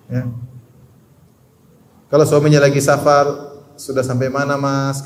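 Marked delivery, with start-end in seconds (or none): 3.8-5.1 gentle, sweet ask to husband; soft polite question. Shown as a demonstration. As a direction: ask softly and kindly. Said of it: "Kan enak dengannya".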